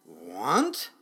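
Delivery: low, loud questioning rumble; rumbling dragon. A narrator's reading. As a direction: throw the word back low and loud, rising at the end. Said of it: "the muffin dragon rumbled".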